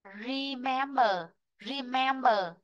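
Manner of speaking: "Remember" with MEM stressed; stress on second syllable. Shown as a demonstration. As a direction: model "Remember" for learners, re-MEM-ber. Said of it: "trong ấm sẽ rơi vị trí thứ hai".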